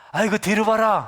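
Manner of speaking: mock Gyeongsang dialect exclamation. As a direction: blurt it like a Gyeongsang grandmother. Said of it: "경상도 말로".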